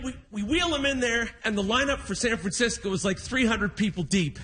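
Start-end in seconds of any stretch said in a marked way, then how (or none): none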